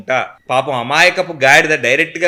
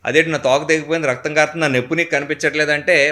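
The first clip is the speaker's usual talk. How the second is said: ordinary delivery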